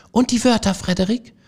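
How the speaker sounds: small field mice calling up to Frederik; squeaky mouse voices. A narrator's reading. The mice ask idle Frederik, in high squeaky voices.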